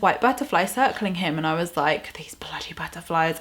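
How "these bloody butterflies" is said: under-the-breath exasperated whisper; soft annoyed whisper; whispered grumble. Retold or imitated. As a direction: mutter it so nobody hears. Exasperated aside during psychic reading.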